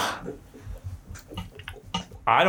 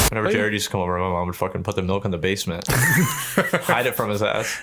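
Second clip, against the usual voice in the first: ordinary talk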